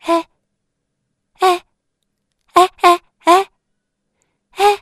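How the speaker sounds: high, loud little frog cries; tiny frog yelling from a pocket; frog calling out. Acted. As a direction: frog shouting to get noticed. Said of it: "elle fait".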